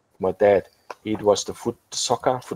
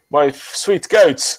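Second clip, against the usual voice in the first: lightly imitated catchphrase, slow, louder and higher; slow, raised mock greeting; Bunty; 6 semitones higher, 5 dB louder, slower than usual. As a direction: draw out the borrowed greeting, lifted and loud. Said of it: "as Bunty, uh, would say".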